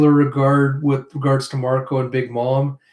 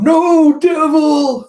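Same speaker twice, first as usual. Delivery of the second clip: high, loud dying scream; anguished cry; 8 dB louder, much slower than usual. Acted. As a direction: cry out in defeat as you die. Dying plant character cries out.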